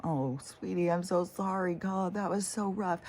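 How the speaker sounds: put-on high soothing baby talk; baby talk to dog. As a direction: coo to comfort a pet. Comforting the tumbled dog.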